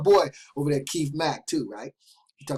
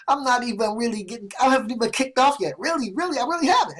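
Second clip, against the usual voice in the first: climbing higher with each "really"; real excitement; 6 semitones higher than usual. Real, not acted. Vlogger gushing to viewers about his future, rising excitement. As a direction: let the pitch soar on "I really haven't". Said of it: "you guys hear the excitement in my voice".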